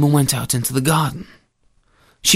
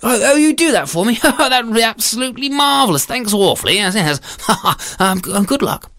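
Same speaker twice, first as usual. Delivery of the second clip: high, gushing thanks; grateful bumbling husband; 7 semitones higher than usual. Acted. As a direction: thank effusively with little stammers. Storyteller voices grateful husband.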